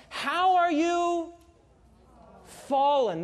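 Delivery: "How are you" slow and high, "fallen" falling; lamenting verse. Real, not acted. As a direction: mourn the words. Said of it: "Notice what he says".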